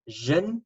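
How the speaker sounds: zhin, voiced zh as in measure; zhin sound. Shown as a demonstration. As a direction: say zhin with a buzzing zh. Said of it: "here we've got a zhin sound".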